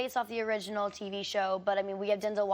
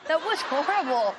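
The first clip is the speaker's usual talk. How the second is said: laughing; 5 semitones higher, 7 dB louder than usual. Real, not acted. Reacting to creepy sound.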